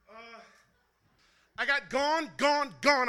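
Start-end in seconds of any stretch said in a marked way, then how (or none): 1.6-3.1 rhythmic poem delivery; spoken word slam refrain; slam poetry. Acted. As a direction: chant the refrain with drive.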